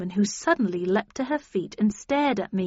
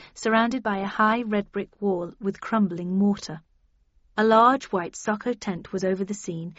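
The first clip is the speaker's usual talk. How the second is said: ordinary delivery, nothing marked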